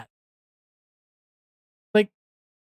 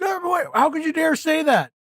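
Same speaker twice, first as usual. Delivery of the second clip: much higher mock pearl-clutching voice; mock outrage; 9 semitones higher than usual. Imitating people freaking out at a remark, high mock outrage. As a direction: squeak it like a scandalised prude. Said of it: "be like".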